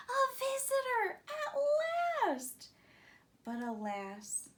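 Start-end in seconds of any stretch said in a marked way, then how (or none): 0.1-2.7 high, loud, thrilled cry; excited moon. A narrator's reading. The moon seeing a chimpanzee rocket coming, thrilled and loud. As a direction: burst out with joy, voice soaring.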